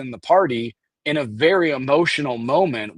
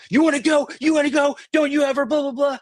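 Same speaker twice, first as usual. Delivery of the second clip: retold yelling; 12 semitones higher, faster than usual. Retelling how Jeremy yelled at Joe, higher mock yell. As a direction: imitate a guy squaring up for a fight. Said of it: "he's yelling at him".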